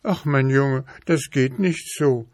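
a touch of sadness, voice lifted; sad. A narrator's reading. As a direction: gently lament that it cannot be. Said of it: "sagte die Prinzessin traurig".

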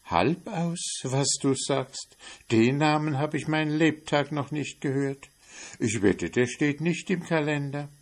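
high, wondering mouse voice; can't believe the odd name; astonished mouse. Acted.

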